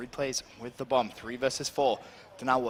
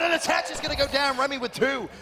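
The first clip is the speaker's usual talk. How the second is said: excited shout; 9 semitones higher than usual. Real, not acted. Caster climax on kills.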